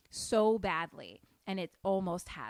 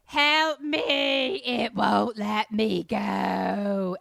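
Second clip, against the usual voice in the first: slowed ghostly moan; 11 dB louder, much slower than usual. Acted. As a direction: drag every word out like a haunted recording. Repeats the overlapped tape voice.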